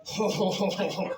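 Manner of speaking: mock "ho, ho, ho" of a paramedic; paramedic's reaction. Paramedic reacting right in her face, mock ho-ho-ho. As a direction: imitate the paramedic's drawn-back reaction, lightly. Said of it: "the paramedic goes".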